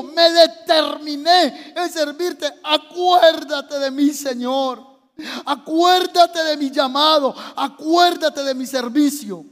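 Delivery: slow, impassioned crying prayer; anguished tearful plea. Acted. Preacher as Ezequías begging to be remembered, anguished plea. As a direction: beg God to remember, stretching the words. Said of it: "empezó a llorar y le dijo".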